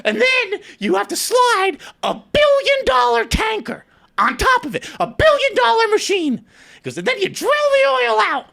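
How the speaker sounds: high, loud excited lecture; mock Michael shouting. Seatmate lecturing the storyteller on oil drilling, high and loud.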